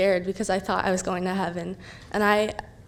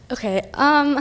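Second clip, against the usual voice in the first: nervous laughter; 3 semitones higher than usual. Real, not acted. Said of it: "I'm super nervous".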